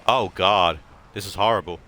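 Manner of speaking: loud groaning "Oh God"; loud dismay. Acted. Drunk pitcher losing, loud dismay. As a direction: cry out in dismay.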